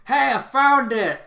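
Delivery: high, loud, slow triumphant shout; drawn-out shout to companions; calling out a find. Acted. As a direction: call it out from behind the group. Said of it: "one of the other Sorcerymon go".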